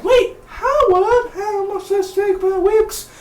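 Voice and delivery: very high squeaky woman's voice, laughing through it; mock falsetto Peggy. Recapping the episode, he voices Peggy in a laughing mock falsetto.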